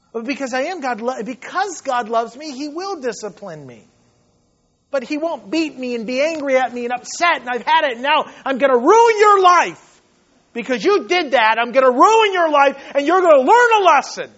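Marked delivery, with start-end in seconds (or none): 4.9-14.3 louder, higher hammed-up threat building up; mock angry God. Preacher mocking the view of an angry God, loud and rising. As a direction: voice an angry God ruining your life. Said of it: "that's how we view God".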